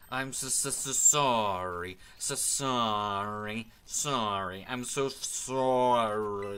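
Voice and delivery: stuttered, drawn-out apology, breaking up; glitchy slow-motion stammer; lagging, glitching voice. Acted. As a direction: drag and stick on words like a frozen connection. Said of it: "he's lagging super heavily and all of his frames are dropping".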